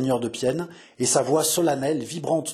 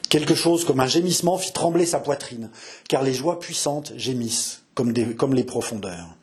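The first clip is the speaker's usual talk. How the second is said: ordinary delivery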